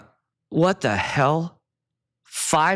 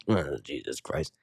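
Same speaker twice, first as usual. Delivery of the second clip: muttered, much softer, lower exasperation; exasperated server; 11 semitones lower, 9 dB quieter than usual. Retold or imitated. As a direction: grumble it under your breath like a fed-up waiter. Servers rolling eyes at returns.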